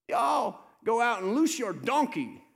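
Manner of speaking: slow, loud, high rebuke; rebuking shout. Retold or imitated. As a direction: call them out for hypocrisy. Jesus rebukes the crowd.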